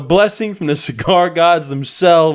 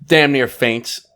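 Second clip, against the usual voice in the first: ordinary delivery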